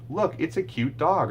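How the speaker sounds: high, bright modelling voice; gentle recast for a toddler; parentese model. Shown as a demonstration. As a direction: say it simply and cheerfully. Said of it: "just adding a second or third word".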